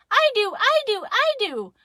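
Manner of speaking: high, loud, repeated clamor; eager shouting. Acted. Kids and Daddy answer Mama in unison, eager shout. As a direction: shout like kids begging for a treat. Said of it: "shouted in unison".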